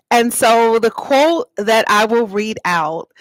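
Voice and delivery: ordinary talk, nothing marked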